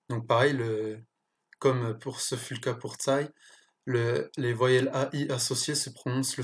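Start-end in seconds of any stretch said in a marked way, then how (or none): none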